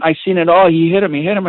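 hammed-up bystander crying out the accusation; excited bystander. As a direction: play the nosy onlooker yelling what he saw. Bystander shouting false testimony.

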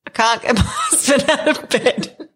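high voice bubbling with laughter; real giggles all through the line; laughing through words. Real, not acted. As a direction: barely get it out for laughing. Laughs retelling her reply.

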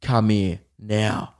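slow, low imitated tough guy; menacing order. Imitating the guy's slow order to come here. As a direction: drag it out like a thug. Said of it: "And he's like".

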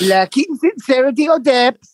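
high, slow definition; mock dictionary recital; reciting like a dictionary. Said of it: "like straight out of a dictionary".

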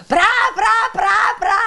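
high, loud imitated concert cry; mock singer's yelp. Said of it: "[name] dando alarido".

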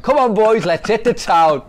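loud, high, gleeful call to go out; rally the lads for a night out; rowdy invitation. Retold or imitated. Manager bursts from the lift urging the players out, loud and gleeful.

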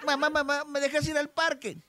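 put-on high-pitched kid asking mom; a child begging for permission, pitched well up; little kid's high, eager plea; pleading child. Joke's child asks mother permission.

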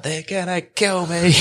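high, drawn-out retold cry of terror; acted-out panic, stretched words; panicked plea. Re-enacts his plea to scooter riders.

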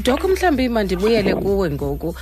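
ordinary talk, nothing marked

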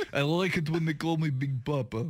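put-on Eastern European accent; mock Eastern European accent. Host mocking co-host as Big Papa, Eastern European accent. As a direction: imitate a thick-tongued Eastern European accent. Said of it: "speak with an Eastern European accent".

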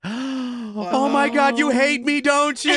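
high, loud, drawn-out mock wail; hammed-up high wailing; mock distraught. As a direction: imitate a wife's panicked realization at full tilt. Wife's panicked reaction, acted out.

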